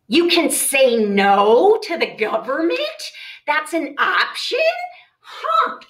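slow, drawn-out incredulous question with a laugh; mock disbelief. Shown as a demonstration. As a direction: act amazed anyone could refuse the government.

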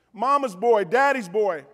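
usual speaking voice

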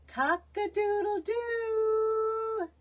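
high, slow drawn-out crow; long falling cock-a-doodle-doo; rooster crow demo. Shown as a demonstration. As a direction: crow it out like a proud old rooster. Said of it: "release air while crowing".

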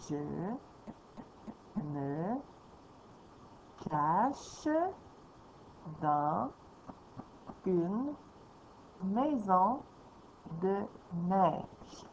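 each word read alone with pauses. Shown as a demonstration. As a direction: read it one word at a time, stopping after each. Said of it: "quand j'ai lu un mot à la fois".

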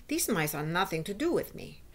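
ordinary delivery

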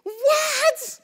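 high, explosive shout; one loud, high-pitched outburst; incredulous shout. Real, not acted. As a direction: yell it out in disbelief. Incredulous preacher after congregation's answer.

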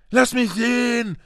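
put-on high, whining-child demand. A narrator's reading. Son demanding to see the letter, high insistent voice. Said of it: "verlangte Dudley".

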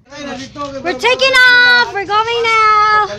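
high, loud, drawn-out cheer; thrilled shout at setting off; excited announcement. Real, not acted. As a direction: call it out slowly, stretching the words.

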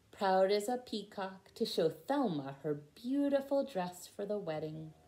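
usual speaking voice

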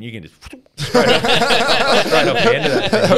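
squealing high with laughter; breathless high giggling; laughing. Real, not acted. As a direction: laugh your way through the words. Laughing through the story.

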